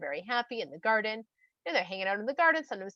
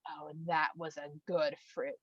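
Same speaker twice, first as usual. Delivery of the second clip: low, slow, soft relish; hushed savouring; 7 semitones lower, 7 dB quieter, slower than usual. Acted. Woman has just bitten the fruit, quietly savouring. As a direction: murmur it slowly with quiet pleasure.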